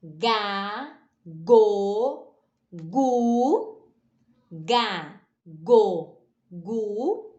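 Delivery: "ga, go, gu" one by one, hard g; chant-like isolated "ga, go, gu" with held vowels; syllable drill. Shown as a demonstration. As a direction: pause between each of "ga, go, gu". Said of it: "quando nós queremos o som".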